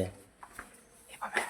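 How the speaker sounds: slow, breathy whisper of the pet's name. Real, not acted. Owner whispering to coax the hiding pet out, slow whisper. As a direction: whisper Babdya's name, slowly.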